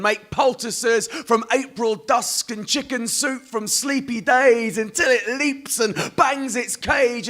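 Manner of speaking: slow, high, drawn-out poetry reading; poem recital. Acted.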